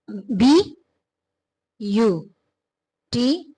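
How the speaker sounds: बी, यू, टी as separate beats with long pauses; syllable by syllable. Shown as a demonstration. Teacher counting syllables of beautiful, बी, यू, टी spaced far apart. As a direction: break it slowly into बी ... यू ... टी. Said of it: "syllables word है".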